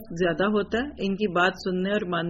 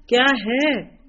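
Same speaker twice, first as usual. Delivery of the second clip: louder, higher irritated "what is it?"; irritated snap; 4 semitones higher, 5 dB louder, much slower than usual. Pretend. Busy mother snapping at her child while on the phone. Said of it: "چیخ رہی ہیں،".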